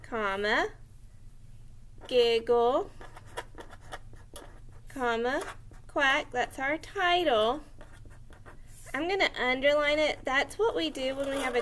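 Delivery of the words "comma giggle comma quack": each word dictated slowly with long pauses; slow dictation. Shown as a demonstration. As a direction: spell it out for kids writing along. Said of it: "We're gonna say".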